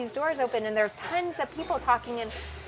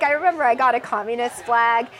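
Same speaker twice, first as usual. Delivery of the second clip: ordinary talk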